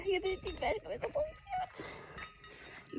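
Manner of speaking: cooing. Real, not acted. Cooing over cute cows.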